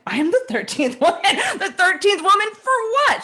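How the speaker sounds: very high, loud echo, laughing through it; amused disbelief. Retold or imitated. Echoing the networking woman's claim, laughing high disbelief. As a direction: repeat it in stunned, laughing disbelief.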